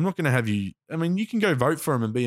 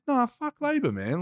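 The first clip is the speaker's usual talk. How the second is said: higher-pitched, heated retelling; losing it, retold. Telling the bloke what he thinks of Labor, heated. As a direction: re-enact an exasperated outburst at raised pitch. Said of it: "I sort of lost it".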